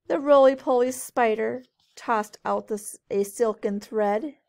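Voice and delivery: lilting storybook read-aloud; reading to children. A narrator's reading. Teacher reading a rhyming book to kids, lilting. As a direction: read it brightly to a group of kids.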